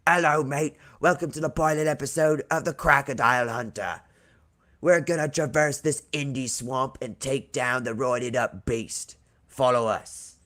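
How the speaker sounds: put-on Australian crocodile hunter; hammed-up Australian accent; Aussie accent. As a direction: do an Aussie TV wildlife host. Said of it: "[name] says in an Aussie accent".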